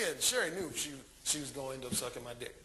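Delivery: usual speaking voice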